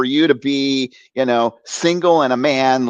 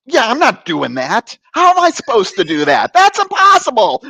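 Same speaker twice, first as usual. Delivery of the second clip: indignant protest climbing higher and higher; popping off; 11 semitones higher, 4 dB louder than usual. Retold or imitated. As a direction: re-enact a teenage outburst at the priest. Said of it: "I popped right off on him".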